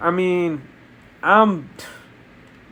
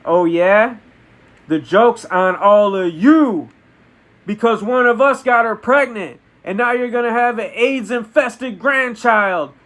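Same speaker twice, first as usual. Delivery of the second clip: raised, emphatic taunting; mounting, higher-pitched villain boast; villainous gloating; 5 semitones higher, 3 dB louder than usual. Acted. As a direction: crow over your beaten enemy. Kang gloats and tops Scott's reveal.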